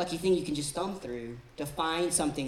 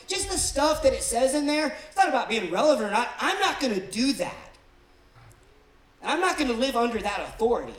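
mock defiant; 5 semitones higher, 6 dB louder than usual. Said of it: "a whole group of skeptics that look at this and go".

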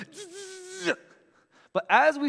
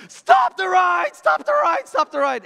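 high, loud panicked yell; screaming stop the ride; 14 semitones higher, 9 dB louder than usual. Retold or imitated. Yelling at the operator to stop the ride, loud panicked scream. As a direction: scream it like you're trapped on a spinning ride. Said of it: "start screaming at the top of our lungs".

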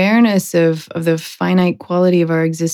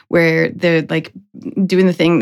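ordinary delivery

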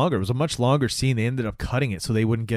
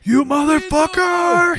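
high, loud, drawn-out scream of fury; hammed-up vengeful yell, stretched out; mock enraged freak-out; 5 dB louder, slower than usual. Husband as she starts dying, high mock rage. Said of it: "her husband freaks out".

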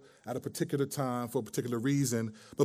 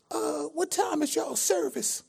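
put-on much higher, slow old-lady falsetto; old church lady voice; 12 semitones higher, slower than usual. Prank caller asking restaurant about church, old lady voice. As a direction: ask sweetly like an elderly churchgoer.

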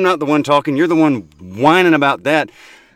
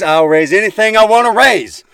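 raised voice; 6 semitones higher, 3 dB louder than usual. Real, not acted. Cohost defying host's order not to raise his voice, rising. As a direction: start deliberate, push the pitch up defiantly. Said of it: "I will raise my voice".

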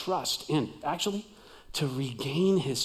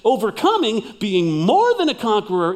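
loud, raised, driving; emphatic preaching; 4 semitones higher, 8 dB louder than usual. Shown as a demonstration. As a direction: lift your voice on overcoming. Preacher repeating line, raised voice.

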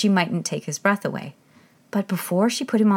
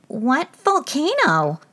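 high, slow bewildered question; puzzled king; 8 semitones higher, 4 dB louder, much slower than usual. Acted. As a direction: ask in baffled alarm.

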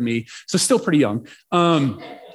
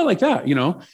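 usual speaking voice

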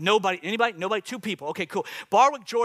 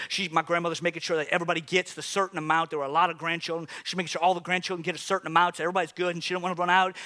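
ordinary delivery

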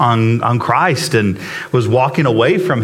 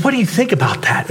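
woman's question; 6 semitones higher, faster than usual. Retold or imitated. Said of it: "she goes".

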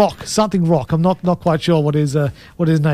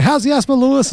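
mock taunting question, pitched higher; teasing mock question; 7 semitones higher than usual. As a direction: tease him like the kids used to. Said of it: "I just had people going".

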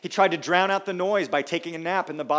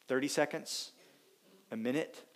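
usual speaking voice

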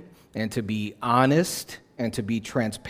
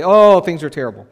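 hopeless complaint; 5 semitones higher, 9 dB louder than usual. Shown as a demonstration. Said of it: "It's one thing to say".

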